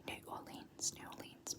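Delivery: "New Orleans" run together, Or-leenz; fancy whispered "New Orleans" with a leenz ending; bougie New Orleans. Shown as a demonstration. Trying the version she finds bougie, Or-leenz. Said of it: "I quite like how that said New Orleans".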